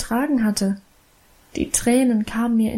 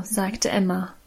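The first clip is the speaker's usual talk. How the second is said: ordinary delivery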